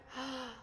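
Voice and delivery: short breathy gasp of wonder; awed gasp. Real, not acted. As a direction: gasp at a stunning view. Awed by the night view.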